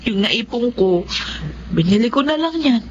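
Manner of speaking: ordinary talk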